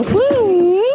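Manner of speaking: high whooping woo; whistle-like woo call. Shown as a demonstration. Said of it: "We go".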